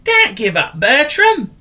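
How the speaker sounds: high, cheery call; encouraging. Acted. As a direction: cheer a clumsy friend on. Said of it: "encouraged Willy Woof".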